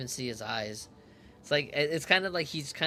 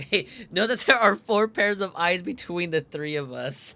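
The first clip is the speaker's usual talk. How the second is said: laughing through the line, louder; 5 semitones higher, 10 dB louder than usual. Acted. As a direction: reread it cracking up. Rereads same line laughing louder.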